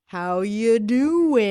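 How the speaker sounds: put-on British person's drawn-out American accent; exaggerated, very slow American-accent imitation; slow mock American accent. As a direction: drag every word in a fake American accent. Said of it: "they slow everything down".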